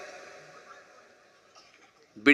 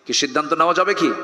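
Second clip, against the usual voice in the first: usual speaking voice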